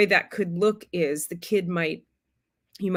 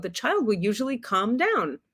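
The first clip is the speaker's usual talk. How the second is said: ordinary talk, nothing marked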